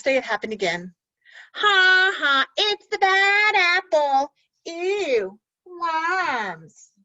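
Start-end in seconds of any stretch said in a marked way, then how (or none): 1.5-6.9 high, loud sing-song jeer; mock him like a playground bully; sneering kids teasing the new boy; mean taunting apples.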